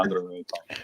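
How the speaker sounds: softly, letting the anger go; calming down quietly. Real, not acted. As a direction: talk yourself calm under your breath.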